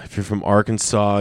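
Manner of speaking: normal talking voice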